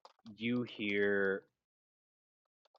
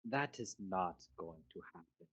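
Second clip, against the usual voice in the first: cold villain voice; 4 dB quieter than usual. Acted. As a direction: purr the contempt like a polite villain. GM voices Urka.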